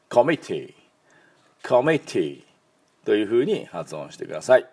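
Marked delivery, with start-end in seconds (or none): 0.1-2.4 コミティ with the stress on ミ. Shown as a demonstration. Teacher stressing the ミ in コミティ for learners. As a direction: hit the ミ in コミティ. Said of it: "ミのところにアクセントがありますよね".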